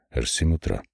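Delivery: quiet, dry mock of "аж семь утра"; sarcastic. Hero mocks Gromov's words.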